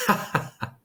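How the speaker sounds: high, breathy hollow laugh from the niche; eerie laugh. Acted. Walled-in Fortunato laughs at his captor, eerie hollow laugh. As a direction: let out a chilling forced laugh. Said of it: "The voice said".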